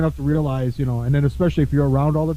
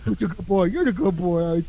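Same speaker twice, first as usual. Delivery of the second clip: put-on dog baby talk; 2 semitones higher than usual. Imitating cooing at a dog.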